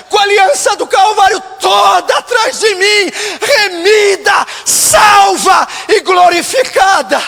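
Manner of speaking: loud, high, impassioned pulpit shout; full-force preaching. Real, not acted. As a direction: cry it out to the whole church, stretching key words. (pregação inflamada, aos brados)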